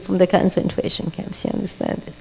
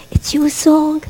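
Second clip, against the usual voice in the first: tender whisper to the congregation; 6 semitones higher, slower than usual. Acted. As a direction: breathe "It's your song" as a secret. Whispered, then said aloud.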